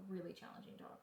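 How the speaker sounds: soft whispered repeat; whispered aside. Real, not acted. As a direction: breathe it as an aside. Repeats phrase as quiet aside.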